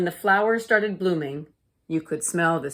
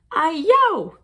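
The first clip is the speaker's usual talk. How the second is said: high, loud cry of effort while chopping; effortful exclamation; 11 semitones higher, 6 dB louder than usual. A narrator's reading. Mother straining to chop tough vegetables, loud effortful cry. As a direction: push out a strained "ay-yo" as the knife bites. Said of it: "she said when she cut them".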